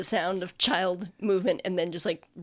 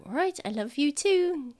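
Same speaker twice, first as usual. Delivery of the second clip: higher, affectionate reply; parent's goodnight; 8 semitones higher than usual. Acted. Parent saying goodnight to the child, higher voice. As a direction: answer the child in a raised character voice. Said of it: "He's like".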